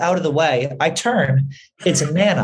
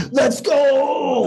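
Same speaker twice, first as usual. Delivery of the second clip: hype shout; 10 semitones higher than usual. Real, not acted. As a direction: cheer it out like a crowd. Hyping the chicken fingers.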